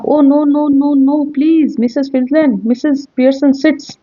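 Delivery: high, panicked pleading; frightened pleading. Acted. Frightened Mrs Fitzland pleading with the couple. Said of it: "कहराते हुए".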